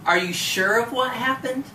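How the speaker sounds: usual speaking voice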